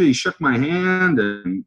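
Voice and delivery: ordinary talk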